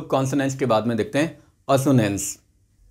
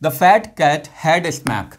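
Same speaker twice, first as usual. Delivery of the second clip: slow, clear classroom reading; slow example read; much slower than usual. Shown as a demonstration. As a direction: read it out slowly for learners. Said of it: "example देख लेते हैं". (धीमे साफ़ उच्चारण)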